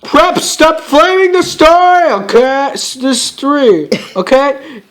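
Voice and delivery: high, slow, loud whiny outrage; mock angry rant. Author telling off preps who flame the story, mock angry. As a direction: stretch it out in hammed-up indignation.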